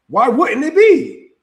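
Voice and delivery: slow, loud rhetorical challenge; why wouldn't it be, slowed and pushed; indignant question. Real, not acted. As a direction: drag it out as if it's obvious.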